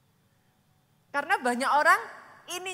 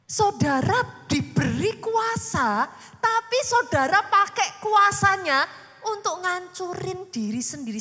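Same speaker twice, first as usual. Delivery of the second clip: preaching at full force; 6 semitones higher, 6 dB louder, slower than usual. Real, not acted. Preacher rebuking congregation.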